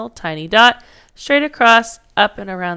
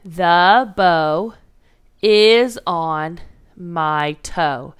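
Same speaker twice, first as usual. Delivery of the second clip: slow, word by word; 1 counting words slowly; much slower than usual. Shown as a demonstration. Teacher to class, counting words in slow steps. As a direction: space out each word to count them. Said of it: "Let's count it out".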